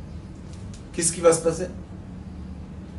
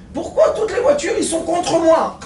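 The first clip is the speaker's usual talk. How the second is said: whiny driver; 10 semitones higher than usual. Acted. Wrong-way driver complains.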